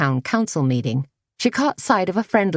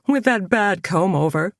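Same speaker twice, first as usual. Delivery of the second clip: a mild mock shriek, higher; 4 semitones higher than usual. A narrator's reading. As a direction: squeal it in playful horror. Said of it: "shrieked Greg".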